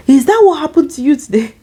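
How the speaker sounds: high-pitched incredulous question; shocked disbelief. Retold or imitated. Line manager's shocked reaction, acted out.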